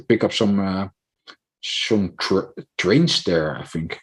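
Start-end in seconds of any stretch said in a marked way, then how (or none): none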